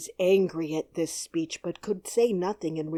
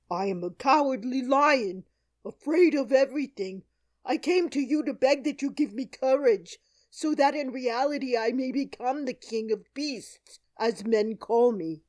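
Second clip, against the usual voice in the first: higher, louder pleading lion voice; cowardly lion; 6 semitones higher, 5 dB louder than usual. A narrator's reading. The lion begging Oz for courage, higher and pleading. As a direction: beg like a big beast who is scared.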